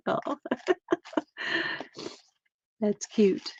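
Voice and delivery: usual speaking voice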